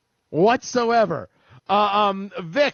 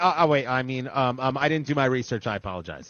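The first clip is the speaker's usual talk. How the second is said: mock sheepish retraction; 6 semitones lower than usual. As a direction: drop low and falter, feigning realization. Mock backing down after sarcastic rant.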